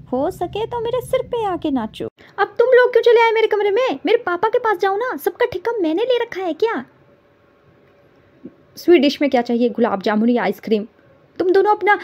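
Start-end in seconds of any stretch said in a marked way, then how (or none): 2.4-5.7 exasperated shout. Acted. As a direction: throw them out of your room. Yelling at intruders.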